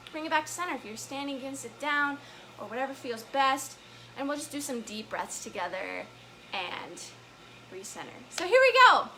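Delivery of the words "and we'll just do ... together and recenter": lower, softer, settling voice; calming. Real, not acted. Host settling kids into deep breaths, calm and soft. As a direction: bring them down gently.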